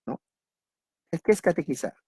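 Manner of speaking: ordinary delivery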